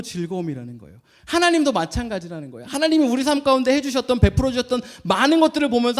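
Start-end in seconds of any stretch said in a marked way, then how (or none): none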